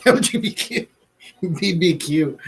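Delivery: giggling through the letters; laughing punchline. Real, not acted. Retelling a TikTok joke and laughing through it. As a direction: laugh while repeating the punchline.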